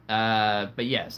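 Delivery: ordinary delivery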